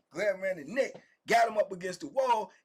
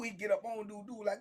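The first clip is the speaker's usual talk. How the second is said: normal talking voice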